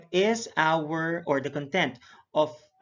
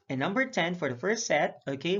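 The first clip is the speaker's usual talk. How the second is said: ordinary delivery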